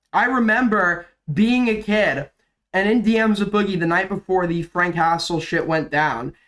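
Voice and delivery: ordinary talk, nothing marked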